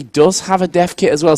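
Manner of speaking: ordinary talk, nothing marked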